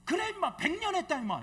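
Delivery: high, loud, furious comeback; hot-tempered shout. Retold or imitated. Number two pastor firing back at the old pastor, shouting. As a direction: blurt it back in a flash of temper. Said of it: "막 발끈하더니".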